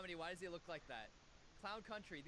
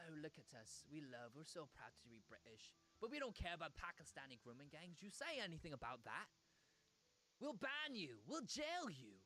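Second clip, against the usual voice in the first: mock British larping; 4 semitones lower than usual. Streamer mocks proud Britons threatening critics, smug British accent. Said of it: "this dumb larping".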